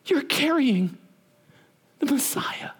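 high, breathy awe fading to a whisper; awed Elizabeth. Retold or imitated. Elizabeth greeting pregnant Mary, high and hushed in awe. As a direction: gasp it out in wonder. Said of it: "hear Elizabeth go".

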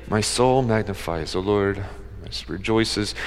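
flat, soft, low monotone; bored mumble of "My soul magnifies the Lord"; flat and lifeless. Shown as a demonstration. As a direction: mumble it with no joy at all. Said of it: "she's not saying".

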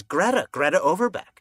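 high, loud exclamation of recognition; sudden recognition. Retold or imitated. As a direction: act out suddenly remembering an old acquaintance. Acting out sudden recognition of acquaintance.

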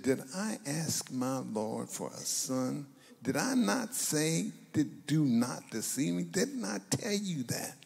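slow, pitch climbing in exasperated questions; frustrated. Acted. Mother confronts the man of God about her son, frustrated. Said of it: "No, no, she is frustrated".

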